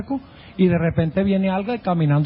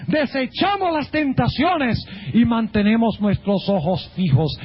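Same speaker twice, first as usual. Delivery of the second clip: raised-pitch, emphatic sermon delivery; fervent preaching; 6 semitones higher than usual. Real, not acted. Preacher urging congregation to look at the cross, fervent preaching. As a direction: punch key words like a preacher.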